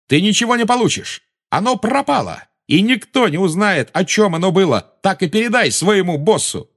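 high, heated taunting; defiant cry. A narrator's reading. Boy tells the witch she gets nothing, shouting defiantly.

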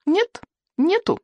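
put-on high, slow childlike objection; contradicting boy. A narrator's reading. Boy arguing back at the chieftain, high stubborn contradiction. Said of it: "возразил Икинг".

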